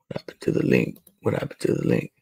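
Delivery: unvoiced muttering; whispered. Real, not acted. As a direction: whisper it to yourself. Reading chat muttered.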